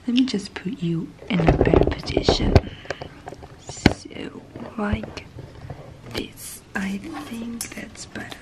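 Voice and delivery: hushed unboxing whisper. Real, not acted. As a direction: breathe the words close to the mic.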